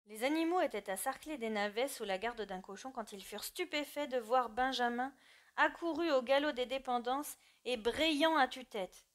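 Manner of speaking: ordinary delivery, nothing marked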